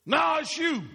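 high, loud accusing retort; blaming shout. Acted. Adam snapping back at God, loud and high.

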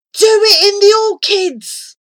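high loud eager shout; inspired shout. A narrator's reading. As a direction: shout the bright idea. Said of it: "Flora shouted in a flash of inspiration".

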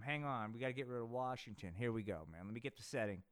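usual speaking voice

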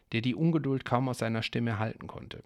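ordinary delivery

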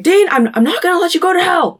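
high, quick, desperate plea; hammed-up pleading with rising insistence; impassioned protest. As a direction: pitch it up, refusing to give in. Sam protests in acted argument.

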